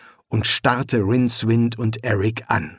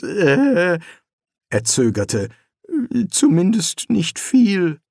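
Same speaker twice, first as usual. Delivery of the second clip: hesitant, higher, slowed voice; unsure; 6 semitones higher, slower than usual. A narrator's reading. Rincewind nervously explaining the Luggage to Eric, hesitant. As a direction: stammer out an excuse. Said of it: "fügte er unsicher hinzu".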